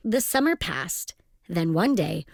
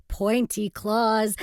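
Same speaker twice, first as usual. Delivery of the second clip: ordinary delivery, nothing marked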